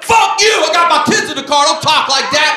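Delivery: high, loud indignant shouting; outraged father bellowing; angry dad. Acted. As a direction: yell back at the other driver. Acts dad yelling back at driver.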